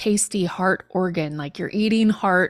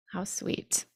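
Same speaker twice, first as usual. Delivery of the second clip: soft unvoiced whisper; 2 semitones lower, 11 dB quieter than usual. Real, not acted. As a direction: breathe it, touched. Touched aside after phrase.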